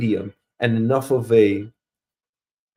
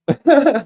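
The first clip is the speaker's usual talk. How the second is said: short high laugh; burst of laughter; 9 semitones higher, 5 dB louder than usual. Real, not acted. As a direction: laugh out loud at the joke. Laughs at own joke.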